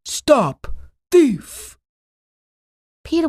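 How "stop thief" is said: loud angry shout of a gardener; calling out. A narrator's reading. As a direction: bellow it after the thief. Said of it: "calling out".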